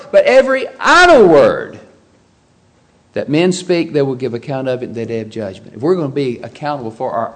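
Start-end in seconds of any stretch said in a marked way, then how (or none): 0.1-1.8 "every idle word" loud and high, idle stretched; emphatic idle. Shown as a demonstration. Preacher driving home the verse, loud and high. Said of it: "that's an emphatic word".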